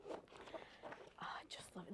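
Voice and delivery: breathy hushed delight; whispering. Real, not acted. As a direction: whisper it to yourself in awe. Admiring product in a hush.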